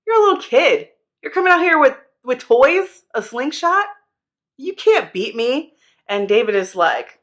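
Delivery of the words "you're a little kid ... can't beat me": high, scornful taunt of a bully giant; mocking giant Goliath. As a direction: sneer down at the little kid. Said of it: "And Goliath is like".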